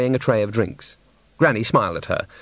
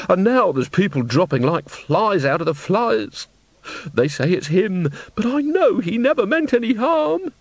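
high, drawn-out wail of protest; tearful old woman; 8 semitones higher than usual. A narrator's reading. Old woman insists to Nanny he meant no harm, tearful. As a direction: protest his innocence, pitch climbing high.

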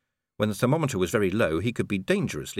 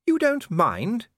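much higher, slow, surprised lady; Lady Sybil; 14 semitones higher, 4 dB louder, much slower than usual. A narrator's reading. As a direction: ask in gentle disbelief. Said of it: "said Lady Sybil".

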